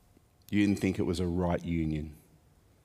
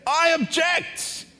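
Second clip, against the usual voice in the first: shouted objection; 6 dB louder, much slower than usual. Pretend. Objecting at a wedding ceremony.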